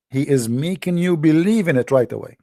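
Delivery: normal talking voice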